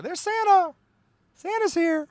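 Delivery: high-pitched mock-excited squeal; excited Santa sighting. Greeting Amazon guys in December as Santa, excited. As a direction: squeal it like Santa has just turned up. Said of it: "That's what I say every time I see".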